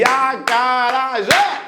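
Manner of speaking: slow, evenly chopped A-ca-ra-jé; syllable by syllable. Shown as a demonstration. Teacher mocking the clapping method, slow syllable-by-syllable. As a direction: beat out each syllable as if clapping it. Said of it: "Vê se dá certo aí".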